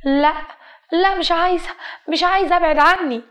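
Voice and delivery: high, urgent acted protest; cornered girl refusing; distressed refusal. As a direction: push them away with a panicky high voice.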